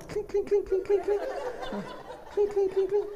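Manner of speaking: very high, rapid clinks; glass clinking imitation. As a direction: squeak out a run of tiny clinks. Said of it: "you hear the glass".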